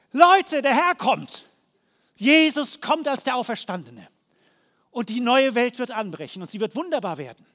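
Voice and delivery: joyful proclamation. Real, not acted. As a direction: burst out and announce it to the whole church. Preacher bursts into proclamation. (lauter, jubelnder Ausruf)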